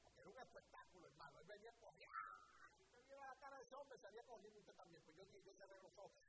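ordinary delivery

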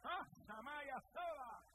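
high, slow ecstatic tongues; speaking in tongues. Retold or imitated. Man reliving his wife's first burst of tongues, ecstatic slow cry. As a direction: let strange syllables pour out, slow and high.

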